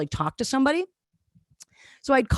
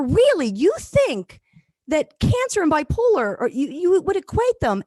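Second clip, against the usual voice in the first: mock disbelief; 8 semitones higher than usual. Shocked question to intake woman.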